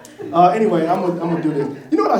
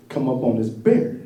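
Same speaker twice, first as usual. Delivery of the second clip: ordinary delivery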